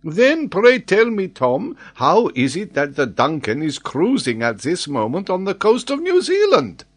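high, loud incredulous demand; stupefied. A narrator's reading. Paganel questioning the old sailor, stupefied. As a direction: demand an explanation, voice climbing at the end. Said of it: "stupefied at the old sailor's replies".

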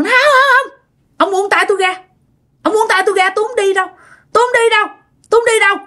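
high, whiny put-on girl's voice; possessed man's girly pleading; squeaky girlish voice. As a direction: resist being dragged off, pitched far up like a girl. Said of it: "nói cái giọng eo éo như là con gái".

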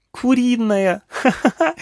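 put-on very high, delighted child squeal and giggle; gleeful mocking child echo, acted; laughing little girl. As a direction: squeal the word back, then laugh like a small girl. Girl laughs at the funny word.

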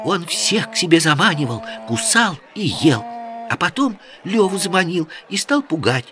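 put-on high, rapid girlish chatter. A narrator's reading. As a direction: rattle it off like an excited girl. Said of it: "быстро затараторила Джамиля".

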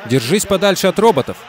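loud, high warning shout. Acted. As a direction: call out over the roar. Shouting over race start. (громкий крик)

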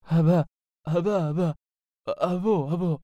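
high, halting, embarrassed stammer; shy. Acted. Genie caught out by the sorcerer, shy stammering. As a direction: stumble sheepishly over the syllables. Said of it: "نظر له الجني بخجل".

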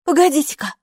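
high, loud interjection of sudden realisation. A narrator's reading. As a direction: cut in as if it just dawned on you. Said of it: "спохватилась Хилла".

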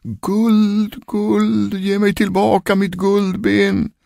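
high, long-drawn wail on one note; ghostly calling voice. A narrator's reading. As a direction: wail it from beyond the grave. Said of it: "rösten ropade igen". (klagande, utdraget spökrop)

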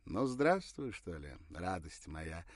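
smiling, slow, tender welcome; warm greeting. A narrator's reading. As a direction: open arms, smiling hello. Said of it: "улыбнулся, раскрывая объятия".

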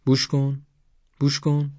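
high-pitched playful coaxing; teasing urging. A narrator's reading. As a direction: urge it in short high bursts. Boy teasingly offers snake to friend.

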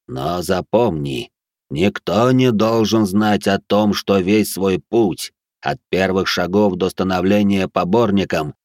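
slow, loud stern warning; ancient guardian voice. Acted. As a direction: warn with divine menace, slowly. (медленный грозный голос древнего стража)

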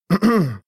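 dry high throat clear. A narrator's reading. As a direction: clear throat before reading. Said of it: "откашлялся".

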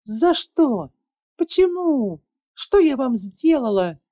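high, loud, desperate cry; panicked shout. A narrator's reading. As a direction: scream it out in panic. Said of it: "громко выкрикивая".

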